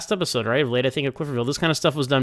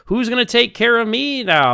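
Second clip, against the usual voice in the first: higher, put-on complaining mother; whiny mom rant; 10 semitones higher, 3 dB louder than usual. Host mocking the mom's rant, higher whiny voice. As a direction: mimic a self-pitying mother's wail. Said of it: "her whole rant about".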